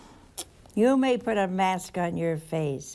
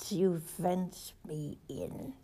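slow whisper; 2 semitones lower, 9 dB quieter, much slower than usual. Acted. Preacher reciting the psalm to the congregation, slow whisper. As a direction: whisper it slowly and softly.

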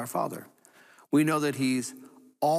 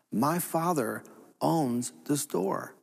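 slow assurance; 2 semitones higher, much slower than usual. Acted. Believer reminds himself of God's care, slow, calm and sure. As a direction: let each word land slowly and calmly. Punchline repeated as personal trust.

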